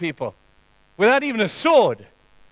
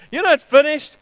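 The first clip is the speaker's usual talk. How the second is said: high, loud punch on NOT; emphatic rebuttal; 8 semitones higher, 6 dB louder than usual. Acted. Preacher answering shame, loud and high. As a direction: hit it hard and high.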